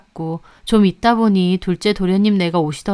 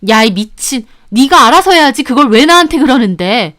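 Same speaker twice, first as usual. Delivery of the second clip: higher, loud defensive retort; angry shouting; 5 semitones higher, 8 dB louder than usual. A narrator's reading. Second sister-in-law fights back, angry shouting. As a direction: fire back angrily mid-struggle. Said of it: "소리 지르고".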